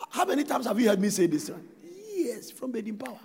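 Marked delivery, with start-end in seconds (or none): none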